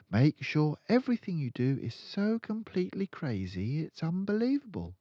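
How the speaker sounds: put-on a calm, certain young girl; Matilda's voice. Matilda correcting her friend, in a high girl's voice.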